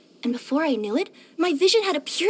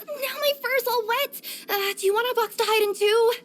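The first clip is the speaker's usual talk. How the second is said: higher, whiny complaint about wet fur; mildly miserable, pitched up; damp discomfort; 7 semitones higher than usual. Acted. Cat caught getting wet.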